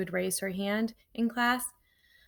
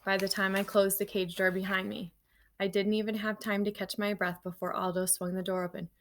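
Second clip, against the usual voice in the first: ordinary delivery, nothing marked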